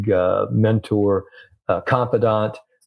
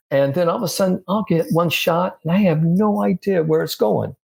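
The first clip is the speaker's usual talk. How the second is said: higher-pitched imitation of a baffled, frustrated golfer; exasperated retelling; 8 semitones higher than usual. Golfer venting to his coach about stray shots, mock exasperation. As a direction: act out mild helpless exasperation.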